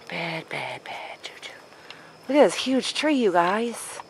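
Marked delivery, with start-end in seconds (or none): 0.1-1.5 whispering. Real, not acted. Repeats warning.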